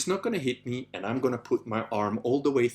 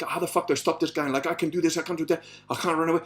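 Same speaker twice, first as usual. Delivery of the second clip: rapid-fire, higher-pitched self-talk; racing inner panic; 5 semitones higher, much faster than usual. Retold or imitated. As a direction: rattle through the options in mock panic. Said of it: "I'm in my head".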